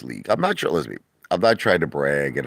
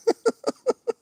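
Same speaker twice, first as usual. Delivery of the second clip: high squealing laugh. Real, not acted. Laughing at own impression.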